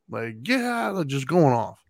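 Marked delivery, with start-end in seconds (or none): 0.4-1.0 pitched-up imitation of a kid screaming; retold scream. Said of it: "her screaming at him".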